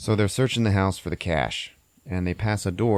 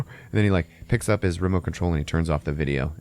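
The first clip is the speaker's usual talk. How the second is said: ordinary talk, nothing marked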